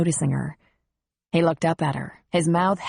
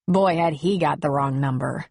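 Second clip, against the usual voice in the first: ordinary delivery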